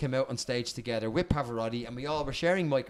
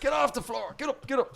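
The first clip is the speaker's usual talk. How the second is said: high-pitched urgent call; raised voice, playfully urgent; mock shout; 11 semitones higher than usual. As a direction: imitate a manager rousing someone. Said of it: "he shouts to me".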